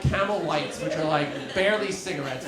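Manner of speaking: usual speaking voice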